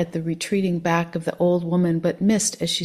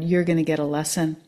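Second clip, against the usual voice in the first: ordinary delivery, nothing marked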